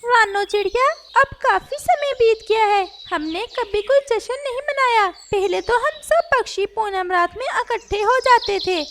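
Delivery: a lively parrot talking to his friend. Acted. Parrot speaks to sparrow.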